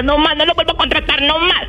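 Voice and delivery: high-pitched exasperated protest; retold refusal. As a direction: protest high, swearing off the singer. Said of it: "Yo les decía". (protesta aguda y exasperada)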